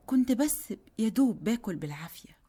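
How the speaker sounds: plain reading voice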